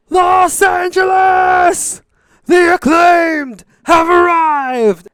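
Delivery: drawn-out, high, full-force arena shout; wrestler's yell. Retold or imitated. Wrestler takes the mic to hype the crowd, yelling. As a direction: bellow it slowly like a ring hype man, pitch way up. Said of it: "he yells".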